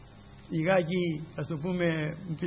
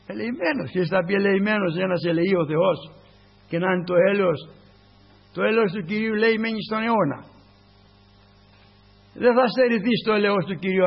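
higher, louder fervent preaching; preaching at full force; 3 semitones higher, 5 dB louder than usual. Acted. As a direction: lift them up with mercy. Climax about mercy.

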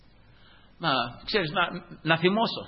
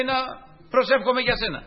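high, louder teasing question; joking mockery; 9 semitones higher, 3 dB louder than usual. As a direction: needle him with a high, mocking question. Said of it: "αστειευόμενος το λέει".

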